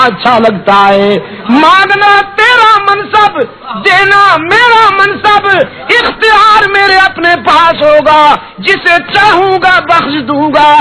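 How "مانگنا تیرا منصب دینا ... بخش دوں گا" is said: much higher, proclaiming shout; voice of God. Acted. God declaring his authority to the Prophet, commanding. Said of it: "فرمایا".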